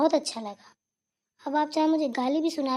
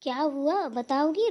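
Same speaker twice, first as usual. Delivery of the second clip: ordinary delivery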